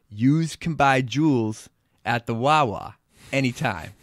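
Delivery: put-on Delco Philly accent, loud and casual. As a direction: lay on a Philadelphia accent. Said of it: "How is my Philly accent?".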